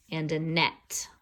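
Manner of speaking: ordinary delivery, nothing marked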